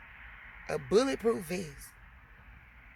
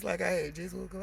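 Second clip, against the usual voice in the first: usual speaking voice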